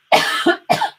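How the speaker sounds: sharp dry cough; real cough breaking in; coughing fit. Real, not acted. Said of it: "Excuse me".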